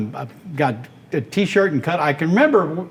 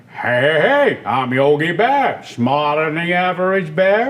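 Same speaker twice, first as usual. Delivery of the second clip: bigger-than-life cartoon bear; larger-than-life bear voice; Yogi Bear; 2 semitones higher than usual. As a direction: boom it out like a proud cartoon bear. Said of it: "Yogi Bear had that bigger-than-life persona".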